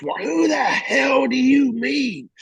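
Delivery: high slow shouted question; screaming. Retold or imitated. Master Chief screaming at the young Marine, high slow yell. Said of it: "you know, just screaming".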